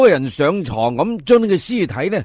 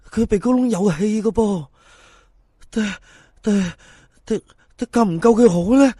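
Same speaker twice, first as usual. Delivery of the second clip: higher, stuttering with fear; panicked dithering; 5 semitones higher than usual. Acted. Fan panicking over whether to save the corpse, stammering. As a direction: torn and scared, tripping on words.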